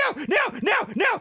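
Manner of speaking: high, loud hammed-up wail of no; mock panic. Telling chat about travel anxiety, high mock panic. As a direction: overdo the panic, rising each time.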